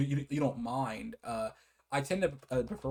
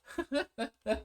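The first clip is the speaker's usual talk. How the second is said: short high giggle trailing down; giggle, falling away at the end; 12 semitones higher than usual. Real, not acted. Laughing at the sparse information.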